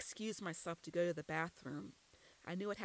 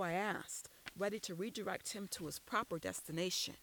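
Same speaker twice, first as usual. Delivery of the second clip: usual speaking voice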